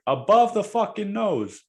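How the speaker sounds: high, loud imitation of the driver; mock yell. Bus driver shouting again, high mock yell. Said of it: "he's like".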